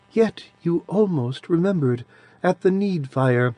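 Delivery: put-on soft childish voice of an ancient being; sweet childish voice. A narrator's reading. Adiran's voice held.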